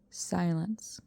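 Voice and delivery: whispered single word. A narrator's reading. Narrator whispers in the dead silence. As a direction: let it hang, hushed.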